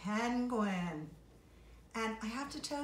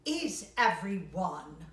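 slow, louder grumbling penguin conductor; grumpy conductor; 8 dB louder, slower than usual. Acted. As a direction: grumble it as the cross boss penguin. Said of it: "muttered the conductor".

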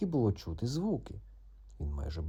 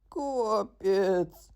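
high far gasped cry; fainting cry; 10 semitones higher, 3 dB louder than usual. Acted. Frog faints in fright, high cry. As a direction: collapse in fright.

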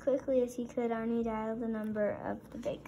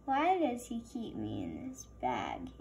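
donut character voice; 11 semitones lower than usual. Acted. Arnie voiced, far timbre.

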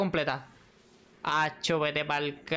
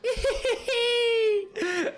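laughing sob. Real, not acted. Overwhelmed reaction, laughing and crying.

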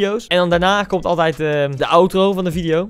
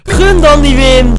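indignant; 6 semitones higher, 9 dB louder, much slower than usual. Real, not acted. Scolds opponent loudly.

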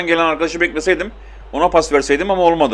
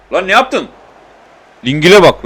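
sharp, louder cry of disbelief at a teammate; annoyed outburst; 4 semitones higher, 6 dB louder than usual. Real, not acted. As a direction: snap at the screen in frustration. Player fumes at teammate's shot.

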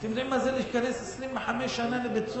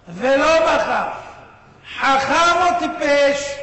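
slow and louder, each word weighed; emphatic question; 8 dB louder, much slower than usual. Acted. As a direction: drag out the question to the crowd. Preacher's rhetorical question to audience. (שאלה רטורית, איטית ומודגשת)

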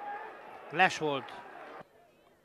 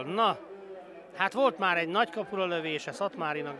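ordinary talk

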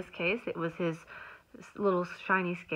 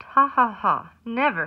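loud haughty storybook laugh; mocking laugh; 3 semitones higher, 10 dB louder than usual. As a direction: laugh at him, then refuse. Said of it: "the rainbow fish just laughed".

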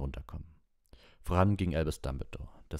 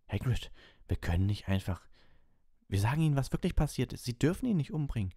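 put-on high, rushed girl's plea; pleading girl; 9 semitones higher than usual. A narrator's reading. Hermione pleads with Hagrid to let them help. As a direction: beg him urgently.

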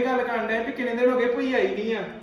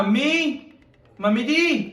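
raised, higher call across the house; 5 semitones higher, 4 dB louder, much slower than usual. Acted. Son calling his mother to come, loud call. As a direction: call her from the next room.

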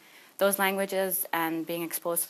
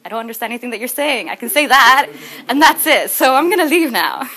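higher, louder, comic escalating panic; acted-out flustered bail-out, pitched up; awkward admission; 6 semitones higher, 13 dB louder than usual. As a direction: blurt it out louder and louder to escape. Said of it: "And I'm like".

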